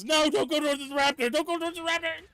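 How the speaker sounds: high, loud yell at the screen; alarmed yelling. Real, not acted. Shouting at a game creature heading for the raptor. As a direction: warn them urgently, shouting.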